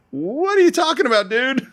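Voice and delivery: high-pitched mock disbelief; incredulous Arcadian. Arcadian questions Leonidas's battle call, mock incredulous. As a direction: voice shoots up in comic protest. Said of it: "the Arcadian is like".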